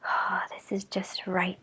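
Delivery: soft whispered contentment; sleepy whisper. Acted. Goldilocks whispers drowsily in the tiny bed. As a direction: breathe it as she drifts off. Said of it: "she said".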